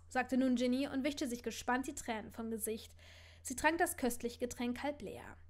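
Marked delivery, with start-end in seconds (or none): none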